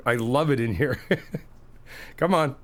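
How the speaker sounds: ordinary talk, nothing marked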